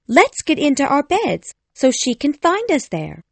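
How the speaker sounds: put-on high, eager girl's voice; Wendy crying out. A narrator's reading. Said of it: "Wendy cried".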